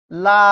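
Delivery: লা said alone as one syllable; syllable demo. Shown as a demonstration. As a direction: give লা its own separate beat. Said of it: "আবার উচ্চারণ করেন তো". (শব্দাংশ আলাদা করে বলা)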